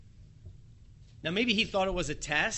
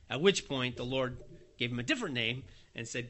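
ordinary talk, nothing marked

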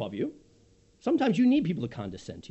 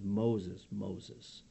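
a hint of a soft divine call, much softer and lower; gentle call; 11 semitones lower, 10 dB quieter than usual. God calling Moses from the bush, soft low summons. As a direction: call his name quietly, like drawing him near. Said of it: "condescends and says".

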